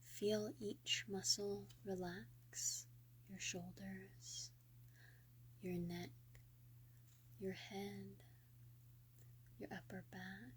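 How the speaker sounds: soft, hushed meditation voice with long pauses; guided meditation. Real, not acted.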